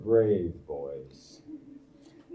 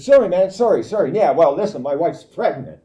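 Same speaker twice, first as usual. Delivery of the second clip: retold exchange; 5 semitones higher, 7 dB louder, faster than usual. Drivers and son exchange.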